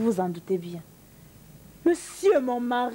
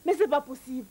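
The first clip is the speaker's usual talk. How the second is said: ordinary talk, nothing marked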